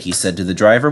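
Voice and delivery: ordinary delivery, nothing marked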